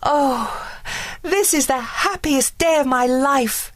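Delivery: put-on high, overjoyed girl; gushing with happiness, high; high, delighted young voice; joyful cry. A narrator's reading. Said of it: "cried Briar Rose".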